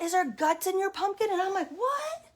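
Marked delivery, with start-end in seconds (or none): 0.0-1.3 put-on high, loud kid's question; little boy yelling. Little boy interrupting the pumpkin story, yelling out. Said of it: "the little boy yells out". 1.7-2.3 very high, rising yelp of disbelief; shocked disbelief. Retold or imitated. Visitor reacting to guts in the pumpkin, shocked disbelief. Stunned reaction to boy's question.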